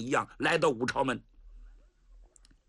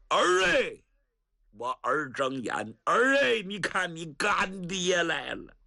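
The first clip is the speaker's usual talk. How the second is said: grieving wail; 2 semitones higher than usual. Acted. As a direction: cry out to the son, then coax him to open his eyes. Godfather calling wounded son.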